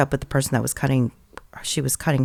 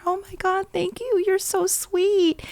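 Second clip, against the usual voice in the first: tearful thanks. Retold or imitated. Grateful wife thanks the stranger, mock tearful and high. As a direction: imitate her tearful gratitude. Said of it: "she just started crying and she said".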